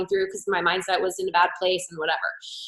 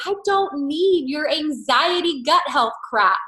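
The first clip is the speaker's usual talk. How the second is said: slow, higher scoffing friend; dismissive friend; 6 semitones higher, slower than usual. Retold or imitated. Friend rejecting products, slow scoffing mock voice. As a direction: sneer it like a fed-up friend. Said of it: "She's like".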